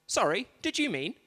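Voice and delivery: exaggerated swooping assistant intonation; phone voice assistant. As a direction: mimic a phone assistant querying you. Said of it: "and it says".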